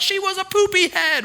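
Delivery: put-on very high, silly kid voice; childish taunt. Kid name-calling.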